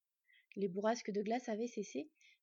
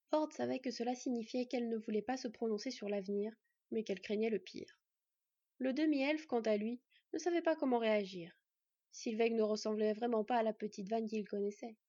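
ordinary delivery

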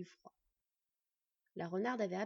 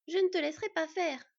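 higher, louder defiant growl; 7 semitones higher, 7 dB louder than usual. A narrator's reading. Fox girl defies the thief, growling loudly. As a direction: snarl it back at him. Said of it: "gronda la renarde".

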